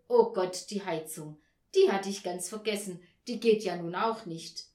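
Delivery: loud exclamation of sudden dismay; dismayed realisation. A narrator's reading. Mother remembering the heating, dismayed realisation.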